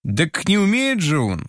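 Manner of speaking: put-on boy snapping back in high indignation; flaring up. Boy protests to the blacksmith, flaring up. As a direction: flare up, hurt and defensive. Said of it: "вспыхнул Толик".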